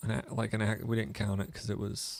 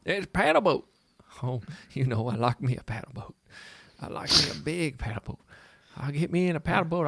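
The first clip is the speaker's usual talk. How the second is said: hillbilly voice; 2 semitones higher than usual. Pretend. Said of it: "making all the hillbillies mad".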